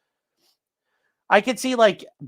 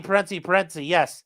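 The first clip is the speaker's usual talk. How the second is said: usual speaking voice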